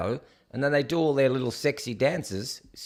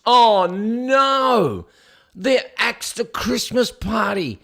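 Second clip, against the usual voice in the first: hammed-up high, loud "oh no"; mock dismay; 7 semitones higher, 6 dB louder, much slower than usual. Host mocks the axed Christmas party with hammed-up dismay. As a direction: play fake horror at the news, higher and louder.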